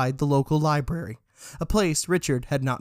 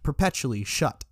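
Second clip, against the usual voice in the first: ordinary delivery, nothing marked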